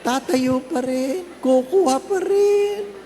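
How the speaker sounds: high, slow, put-on eager voice; mock eager dessert grab. Full churchgoer still getting up for dessert, high slow mock eagerness. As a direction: drag it out like a greedy child.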